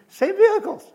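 mock exasperation. Mocking neighbour's endless routine.

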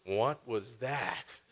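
slow, drawn-out amazed question; stunned amazement. Retold or imitated. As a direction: let the wonder stretch every word. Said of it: "And I'm like".

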